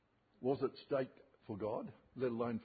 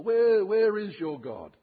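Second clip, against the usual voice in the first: taunting; 7 semitones higher, 9 dB louder, slower than usual. Retold or imitated. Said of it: "the world says".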